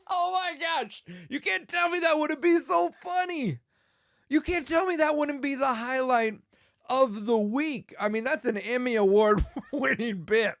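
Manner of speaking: high, loud, giddy insistence; laughing excitedly. Real, not acted. Comic selling own sketch to listeners, giddy with laughter. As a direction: gush through your own giggles.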